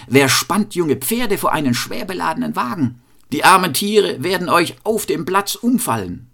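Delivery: high-pitched reproach; indignant exclaiming. A narrator's reading. Cobbler to farmer with an overloaded wagon, exclaiming indignantly. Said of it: "rief er".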